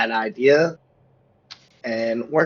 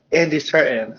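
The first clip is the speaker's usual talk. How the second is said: ordinary talk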